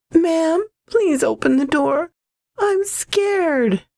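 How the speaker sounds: put-on high, scared little child voice; pleading child voice. A narrator's reading. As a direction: beg like a frightened small child. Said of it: "the child's increasingly desperate pleas for help".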